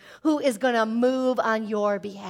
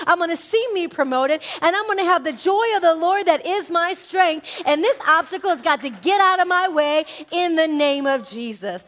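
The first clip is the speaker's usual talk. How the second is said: high, loud declaration of faith; full-force preaching; 6 semitones higher, 6 dB louder than usual. Acted. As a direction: declare victory at full volume.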